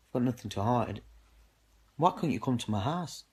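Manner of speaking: ordinary delivery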